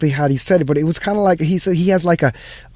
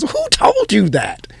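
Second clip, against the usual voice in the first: slow, higher, pointed counselor question; guidance counselor asking; 7 semitones higher, slower than usual. Retold or imitated. Guidance counselor's first question to the student, slow and higher. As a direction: ask it slowly like a probing counselor. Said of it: "the guidance counselor's first question was".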